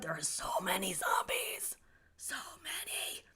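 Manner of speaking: whispered, slowing at the end, mock eerie; spooky whisper. Streamer joking about zombies where she lives, mock spooky whisper. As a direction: let it creep out like a ghost story.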